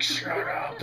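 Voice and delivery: mock-angry forced whisper; whispered retort. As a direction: tell them off without voice. Snapping back at the lady.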